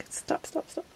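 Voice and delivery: whispered stop between laughs; begging yourself to stop laughing; faint breathy stop; breathless laugh. Real, not acted. Laughing, telling herself to stop.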